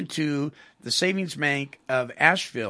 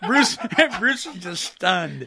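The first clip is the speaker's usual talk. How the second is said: laughing; 5 semitones higher, much slower than usual. Real, not acted. Host laughing at cohost.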